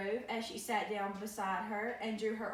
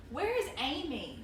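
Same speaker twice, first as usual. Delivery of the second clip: mother voice; 5 semitones higher than usual. Acted. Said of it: "she asked".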